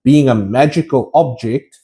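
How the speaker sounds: ordinary delivery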